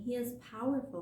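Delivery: ordinary delivery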